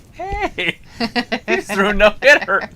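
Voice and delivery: very high-pitched mock greeting; delighted surprise. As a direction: pipe up in pleased surprise. Acts out his delighted surprise.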